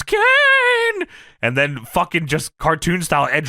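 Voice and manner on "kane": very high, drawn-out mock yell; hammed-up high wail; Paul Bearer. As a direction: call as if from a distance. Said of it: "Paul Bearer yelling from the distance".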